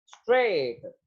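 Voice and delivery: ordinary talk, nothing marked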